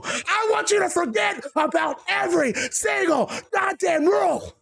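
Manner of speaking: high, yelled wrestling promo rage; furious shout. Acted. Seth screams at Damien, furious yelled threats. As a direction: scream your hatred at him.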